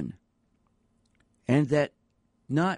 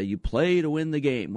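quoting a famous coach, punched out higher and louder; emphatic coach quote; 6 semitones higher, 4 dB louder than usual. Retold or imitated. Radio host quoting Herm Edwards to listeners, emphatic. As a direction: belt out the famous catchphrase. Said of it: "Herm Edwards was quoted to say".

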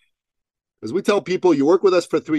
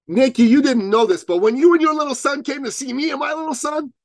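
high, mock-crying voice; imitated tearful woman; 12 semitones higher, 4 dB louder than usual. Crying client to speaker on stage, imitated tearful voice. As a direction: imitate her wailing through tears, pitched way up. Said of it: "She's still crying".

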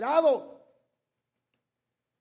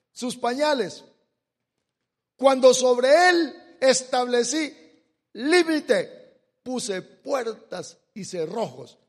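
loud, slow, solemn scripture read; preaching scripture reading; 4 semitones higher, 9 dB louder than usual. Acted. Preacher reads Job to the congregation, booming. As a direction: proclaim the verse to the congregation.

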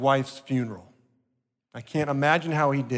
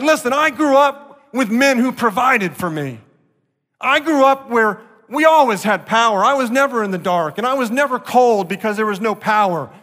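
higher and louder, indignant complaint; a wife scolding her broke husband, higher and louder; imagined scornful wife; 7 semitones higher, 7 dB louder than usual. Retold or imitated. Pastor voicing an imagined wife's scornful rebuke, higher and louder.

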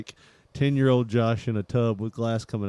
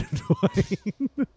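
laughing punchline; 12 semitones higher than usual. Acted.

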